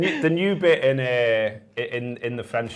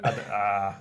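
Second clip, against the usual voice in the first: usual speaking voice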